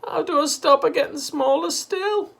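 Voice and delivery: high, loud desperate cry. A narrator's reading. Missus Cranky holding tiny Grandma, high and desperate. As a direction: cry out helplessly.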